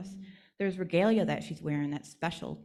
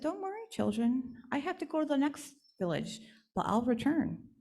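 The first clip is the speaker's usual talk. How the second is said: gently higher doll voice, comforting; reassuring doll; 7 semitones higher than usual. Acted. Doll reassuring the sad village children, gently higher. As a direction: soothe the children before leaving.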